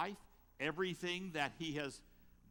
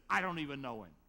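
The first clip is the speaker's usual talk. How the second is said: louder, flat-out denial; a louder retold denial; firm denial; 8 dB louder, faster than usual. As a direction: insist you never knew him. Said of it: "He says".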